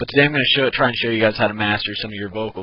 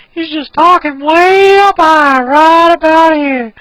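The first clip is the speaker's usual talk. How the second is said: put-on much higher, much louder, slow old man squeak; Herbert voice; 12 dB louder, slower than usual. Shown as a demonstration. Teacher keeps Herbert voice for viewers, high and slow. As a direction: hold it way up high and drawn out. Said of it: "It's just a higher voice".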